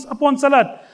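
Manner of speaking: normal talking voice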